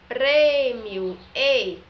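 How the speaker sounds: PRÊMIO loud, high and stretched, then its "ei" alone; closed "ei" sound. Shown as a demonstration. Teacher showing pupils the closed E, loud drawn-out PRÊMIO, ei. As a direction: punch the closed first vowel of PRÊMIO, then isolate it as "ei". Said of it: "formando o som de ei".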